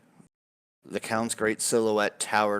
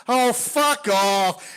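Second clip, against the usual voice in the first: loud, high, drawn-out jeer; yelled heckle; 8 dB louder, much slower than usual. Acted. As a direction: heckle the storyteller from the crowd. Heckler in the crowd yells.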